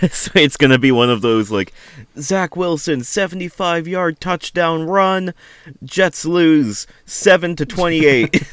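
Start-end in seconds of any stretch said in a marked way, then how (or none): 2.2-8.4 higher, slow mock sportscaster call; mock announcer. Joking about Zach Wilson on the Jets, mock broadcaster call. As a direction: ham up a highlight-reel play-by-play, drawn out.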